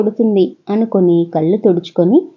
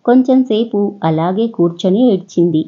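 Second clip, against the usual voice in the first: usual speaking voice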